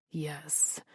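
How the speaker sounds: soft whispered yes; murmured. A narrator's reading. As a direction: breathe it out quietly. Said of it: "he murmured".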